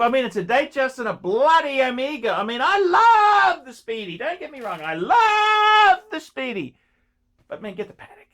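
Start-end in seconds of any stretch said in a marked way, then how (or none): 2.6-6.7 high and loud, almost crying out; overwrought shout. Real, not acted. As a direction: wail it like a wounded fan. Protesting love of Omega.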